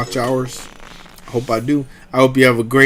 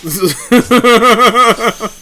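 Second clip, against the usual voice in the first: high loud burst of laughter; laughing; 11 semitones higher, 7 dB louder than usual. Real, not acted. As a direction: let a big high laugh out. Laughs at own joke.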